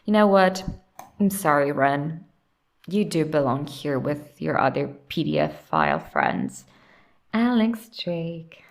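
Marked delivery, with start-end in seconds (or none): none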